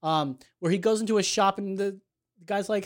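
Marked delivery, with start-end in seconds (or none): none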